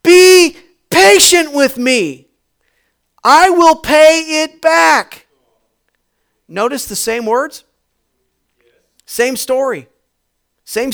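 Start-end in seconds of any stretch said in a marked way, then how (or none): 0.0-5.3 begging. Acted. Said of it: "He begged him".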